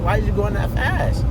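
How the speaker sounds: ordinary delivery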